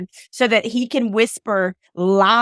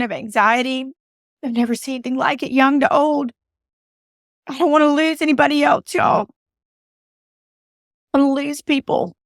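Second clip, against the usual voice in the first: fighting back tears, say it higher; tearful plea; 5 semitones higher than usual. Real, not acted. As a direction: voice higher, choked up with real tears. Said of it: "I always cry on this podcast".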